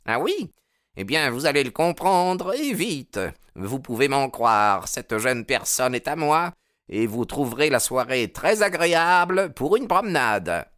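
high, put-on threatening voice; menacing rival. A narrator's reading. Baldwin telling the boarder she is his, menacing. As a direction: stake a claim with sneering menace. Jealous rival orders newcomer away.

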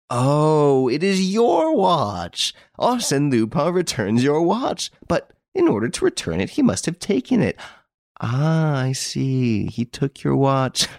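high, gleeful exclamations; hilarious delight. A narrator's reading. Devan realises the detective's watch was stolen, gleeful. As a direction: crow with mounting amusement, pitch riding high. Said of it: "He roared with laughter".

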